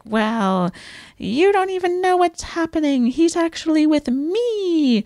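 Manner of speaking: high, taunting quote of Norma; imitating a jealous diva; Norma's jealous taunt. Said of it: "and she's like".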